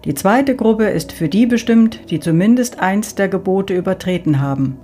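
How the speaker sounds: Saint Peter announcing. Acted. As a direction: direct the souls like a gatekeeper.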